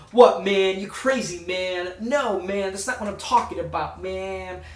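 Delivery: drawn-out cool New Yorker talk; lightly voiced street-wise New York character; Reno impression. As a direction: do a cool New York guy calling everyone "man". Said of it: "he kinda talks like this".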